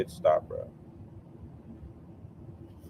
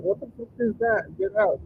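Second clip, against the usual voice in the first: mock alarmed; 7 semitones higher than usual. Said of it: "He's like".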